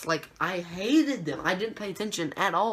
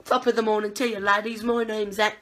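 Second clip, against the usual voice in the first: hammed-up British greeting, higher and louder; mock British accent; 3 semitones higher, 4 dB louder than usual. Vlogger restarting his recap intro, mock British accent. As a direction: put on a playful British voice. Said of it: "doing the British voice".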